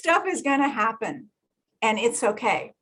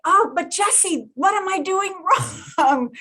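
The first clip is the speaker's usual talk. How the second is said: whiny pleading client; 7 semitones higher, slower than usual. Retold or imitated.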